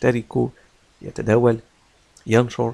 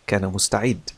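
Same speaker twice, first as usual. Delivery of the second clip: ordinary delivery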